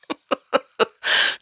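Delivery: breathy little laugh; airy laugh. Real, not acted. Coach laughing at her own point, airy laugh. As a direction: let out a short airy laugh.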